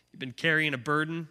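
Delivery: usual speaking voice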